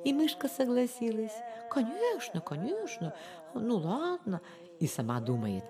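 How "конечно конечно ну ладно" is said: high, soft, placating mouse; meek agreement. A narrator's reading. Mouse agreeing with the fox about tomorrow's hunt, meek and soft. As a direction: agree softly in a small high voice.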